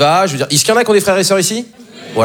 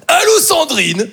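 high, drawn-out game-show host call; Jean-Luc Reichmann; 12 semitones higher, much slower than usual. Acted. [name] on the phone doing his TV host imitation, high and drawn-out. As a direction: stretch each phrase in a high, showy put-on voice. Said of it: "il aime bien faire des imitations".

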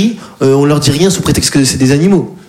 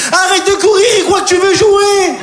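much higher, slow prim lady voice; dog owner lady; slower than usual. Retold or imitated. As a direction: play the calm dog lady, high and slow. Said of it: "elle dit". (la maîtresse du chien, voix très aiguë et lente)

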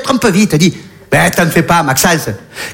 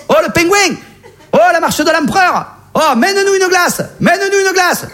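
very high excited squeal; 13 semitones higher than usual. Acted. Comic calling penguins to fetch ice cream, high squeal. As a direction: squeal it up at the top of the voice.

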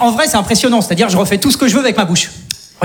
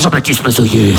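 much lower, booming name; trailer title drop; 12 semitones lower, slower than usual. Acted. Cop film parody, deep name announcement. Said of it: "film de flic".

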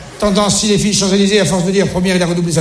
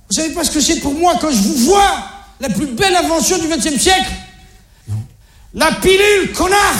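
much higher, exasperated rant; acted outburst; 7 semitones higher than usual. Father answering his own question to his kids, high-pitched outburst. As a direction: blow up and throw the answer at them.

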